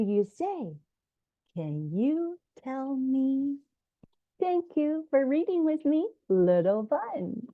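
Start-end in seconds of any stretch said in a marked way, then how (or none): none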